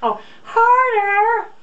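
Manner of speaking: high, slow, loud hammed-up moan; moaning breasts voice. Cohost voicing the breasts again, drawn-out mock moan. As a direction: stretch it out like a ridiculous moan. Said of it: "Your breasts sound like an old man?".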